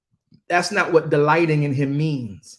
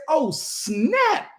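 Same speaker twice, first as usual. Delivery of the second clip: amazed exclamation; 12 semitones higher than usual. Retold or imitated. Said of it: "he gets there and says".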